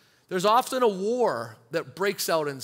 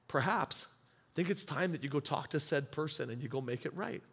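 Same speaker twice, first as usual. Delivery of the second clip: low, soft and quiet, lightly voiced; a calm, quiet answer from above; gentle voice of God; 5 semitones lower, 10 dB quieter than usual. God gently answering a repentant believer, low and soft.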